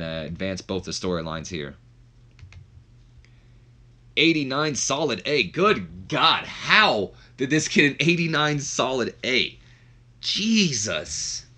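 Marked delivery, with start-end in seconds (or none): 4.2-11.5 high, drawn-out disbelief; real astonishment. Real, not acted. Host stunned by an eighty-nine solid A, incredulous. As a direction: exclaim it, stretching the key words. Reacts in disbelief to match score.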